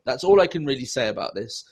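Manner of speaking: ordinary talk, nothing marked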